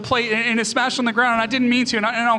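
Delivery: imitating a frightened son, high and loud; acted anxious kid pleading with dad; panicked child's apology. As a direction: blurt out excuses in a high rush. Young son apologizes for smashed plate.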